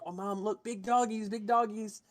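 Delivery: lightly voiced excited child; excited kid. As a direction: pipe up like a kid tugging at Mom. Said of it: "There's some kids that actually are like".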